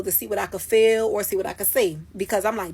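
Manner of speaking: ordinary talk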